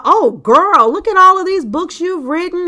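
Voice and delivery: imitating a chatty caller; mock gushing saleswoman. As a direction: gush over the books like a pushy seller. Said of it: "she goes on and on".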